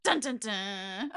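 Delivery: slow, low suspense sting; dramatic dun dun dun. Pretend. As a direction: sing the ominous sting.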